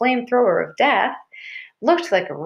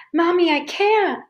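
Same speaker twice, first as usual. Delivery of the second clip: high-pitched, tearful refusal; small child overcome, protesting helplessly; 10 semitones higher than usual. A narrator's reading. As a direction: plead like a crying little girl. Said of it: "The tears really started coming".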